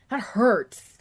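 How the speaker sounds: ordinary talk, nothing marked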